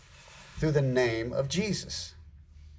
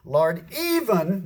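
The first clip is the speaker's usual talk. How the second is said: "even" drawn out slowly and raised high; stress on EVEN; 6 semitones higher, 4 dB louder, much slower than usual. Shown as a demonstration. Disciples reporting excitedly to Jesus, stressing "even". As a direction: lean long and hard on "even". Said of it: "I'm gonna emphasize that word".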